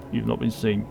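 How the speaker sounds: ordinary talk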